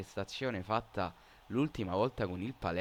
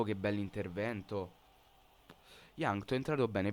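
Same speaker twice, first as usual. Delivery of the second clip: ordinary delivery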